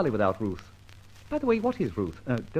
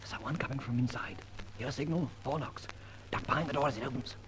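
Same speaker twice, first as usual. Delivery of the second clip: hushed; 11 dB quieter, faster than usual. Acted. Hiding behind the door, speaking hushed. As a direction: keep it down, someone's near.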